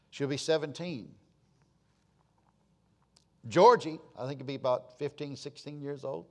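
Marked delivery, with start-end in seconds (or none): none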